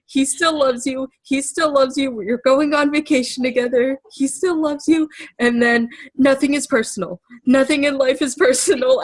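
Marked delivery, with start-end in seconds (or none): 0.1-5.1 higher, pleading self-reassurance; self-soothing mantra. Retold or imitated. To herself while driving, pleading self-reassurance. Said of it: "talking to myself in my brain saying". 7.5-9.0 mock crying. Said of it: "as I'm crying".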